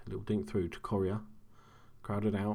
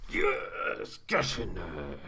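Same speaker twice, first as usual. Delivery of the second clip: stifled celebration; much slower than usual. Real, not acted. Gamer celebrating a goal at two in the morning, stifled cheer. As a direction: celebrate hard without waking the house. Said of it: "two in the morning and my missus will kill me".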